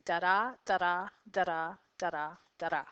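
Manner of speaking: each "da-da" stressed on the second da; iambic rhythm. Shown as a demonstration. Teacher demonstrating the poem's cadence, iambic da-DA beat. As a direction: chant "da-da" in even pairs, weak then strong. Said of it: "it gives you a cadence or a rhythm".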